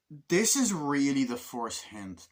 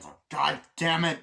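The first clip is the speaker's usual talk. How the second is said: loud, higher mock outburst; exasperated cursing; 5 semitones higher, 8 dB louder than usual. Driver spotting the police car coming, exasperated cursing. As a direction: curse at bad luck.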